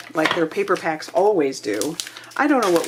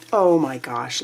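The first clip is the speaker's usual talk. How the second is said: ordinary delivery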